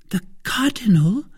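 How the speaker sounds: high-pitched incredulous question from the wife; startled cry. A narrator's reading. Wife hearing of the Cardinal, startled. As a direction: echo it in alarmed disbelief. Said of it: "cried Madame Bonacieux".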